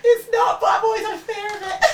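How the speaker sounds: very high, laughing squeal; laughing protest. Real, not acted. Defending her dislike of Toms to teasing friends, laughing protest. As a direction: protest loudly through giggles.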